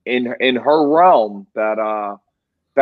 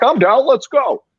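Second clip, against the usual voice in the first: higher-pitched mock readiness to fight; imitating eager challenger; 11 semitones higher, 4 dB louder than usual. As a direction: act out the kid jumping at a scrap. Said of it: "The kid was like".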